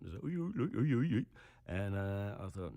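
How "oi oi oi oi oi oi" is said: higher-pitched mock Bristolian accent; Stephen Merchant. Actor mocking the Bristolian accent he looked up, exaggerated Bristol impression. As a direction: caricature Bristol accent on nonsense syllables. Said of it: "the Bristolian accent, which is like, Stephen Merchant".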